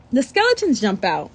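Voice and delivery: usual speaking voice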